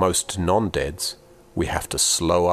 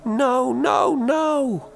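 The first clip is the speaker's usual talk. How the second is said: high anguished wail; mother's wailing protest; crying no; much slower than usual. Acted. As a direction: cry it out in despair. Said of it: "Mama cried, saying".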